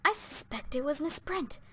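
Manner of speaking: whisper. A narrator's reading. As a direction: hush it to the others. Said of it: "Vera Claythorne whispered".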